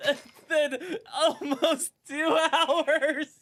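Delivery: drawn-out, laughing and half-crying in relief; celebrating crafting Pokeballs, laughing and half-crying; laughing through words. Real, not acted. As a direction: laugh it out, almost in tears. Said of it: "laugh cry".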